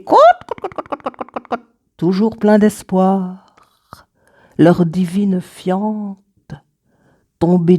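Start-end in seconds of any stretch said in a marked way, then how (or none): 0.0-1.7 clucking hen. Acted. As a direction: imitate a hen, first cluck high, then tiny clucks trailing away. Imitates hens inside the poem. (caquètement de poule imité)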